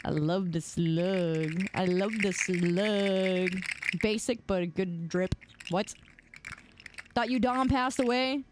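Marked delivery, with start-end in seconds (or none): 0.1-3.6 slow, drawn-out doting. Pretend. Streamer doting on the slug sound, slow and drawn out. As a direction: stretch each word out lovingly, slowly.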